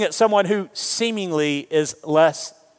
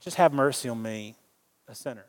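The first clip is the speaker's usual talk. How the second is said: much softer, lower, fading to a breath; hushed plea; 5 semitones lower, 8 dB quieter than usual. Retold or imitated. Tax collector pleading with God, hushed and low. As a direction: beg for mercy almost under your breath.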